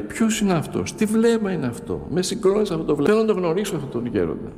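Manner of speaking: put-on high, eager questions from a moved young man; moved youth. Young biker asks the children about the elder, high and deeply moved. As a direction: voice him asking, deeply stirred.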